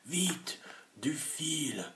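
high, breathless urgent cry; urgent call. A narrator's reading. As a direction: hurry them for the rope. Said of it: "cria James".

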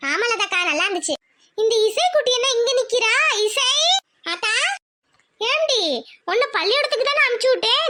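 usual speaking voice

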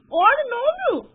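loud, high what's-happening yell; alarmed cry. Real, not acted. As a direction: shout out in alarm. Alarmed at game. (oyunda panikle bağırış)

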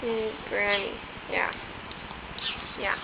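normal talking voice

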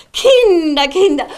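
normal talking voice

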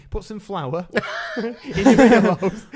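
slow and loud through laughter; laughing. Real, not acted. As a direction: giggle the words out slowly. Laughing at own party trick idea.

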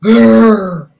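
loud, drawn-out growl; rhino growl. A narrator's reading. Reader as rhinoceros threatening Fluffy, growling. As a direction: growl like a big grumpy rhino. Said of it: "said the rhinoceros".

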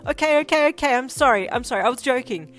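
loud, high, frantic pleading; alarmed backpedalling at full volume; panicked apology. A narrator's reading. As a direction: cry out for mercy in a rush of repeats.

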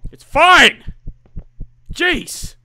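yelled in frustration; sudden shout. Real, not acted. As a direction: explode on the last word. Sudden outburst after resigned line.

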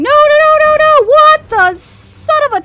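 high frantic shouting; panicked yelling. Real, not acted. Caught off guard by a new monster, frantic yelling. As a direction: yell in sudden fright.

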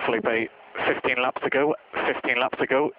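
usual speaking voice

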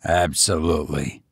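low, firm master; low-pitched, certain reply; assertive banker. A narrator's reading. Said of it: "asserted the banker".

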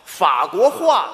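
slow, clear 法国话, ending properly in hua; careful correction. Shown as a demonstration. Partner correcting the comic, slow and clear 法国话. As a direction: spell out 法国话 slowly, fa first, hua last.

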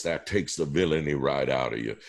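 usual speaking voice

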